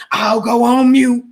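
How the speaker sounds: slow, whiny mock of "I'll go on mute"; a snide parody of the caller, drawn out; mocking impression. Host mimics the caller's whiny "I'll go on mute". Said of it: "That's why you kept saying".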